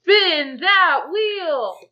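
slow, drawn-out and loud; dramatic announcement. Real, not acted. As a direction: build it up like a big reveal. Nat one triggers wild magic.

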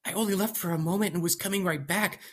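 high worried nervous man; fretting scientist voice; anxious young scientist. Acted. Scientist explains himself.

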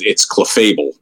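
"cleffable" said cluh-FAY-bul; correcting pronunciation. Shown as a demonstration. Correcting the co-host's Pokemon name, "cleffable" as cluh-FAY-bul. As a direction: stress the long AY in the middle of "cleffable". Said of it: "And by the way".

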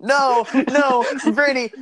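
high, loud laughing protest. Real, not acted. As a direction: shout to stop him. Stopping friend's Alexa prank.

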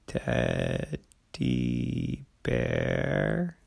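puzzled, slow tortoise reply. A narrator's reading. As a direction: ponder it slowly like an old tortoise. Tortoise voiced in story.

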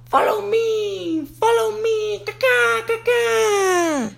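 high bird voice, follow me then caw-caw; calling dove. Acted.

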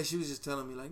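usual speaking voice

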